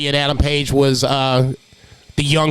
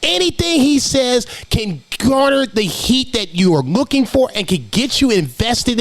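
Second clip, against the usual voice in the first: amazed disbelief; 8 semitones higher, slower than usual. Retold or imitated. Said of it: "I was like".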